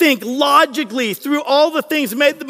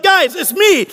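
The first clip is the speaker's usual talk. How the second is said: raised-pitch acted plea; higher, insistent retelling; 6 semitones higher, slower than usual. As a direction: act out convincing doubters it's you. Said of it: "he's basically sitting there going like".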